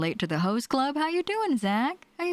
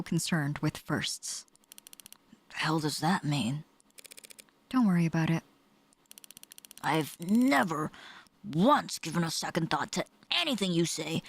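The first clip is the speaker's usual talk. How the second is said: game characters; 4 dB quieter than usual. Acted. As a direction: read the grim case lines in a hushed tone. Voices detective game characters.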